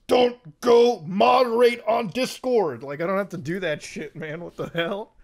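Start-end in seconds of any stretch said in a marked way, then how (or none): 0.1-2.8 slow, loud, strained mock warning to himself; a hammed-up inner voice, slow and loud; mock self-warning. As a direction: bark it like holding yourself back. Acts out restraining his own urge.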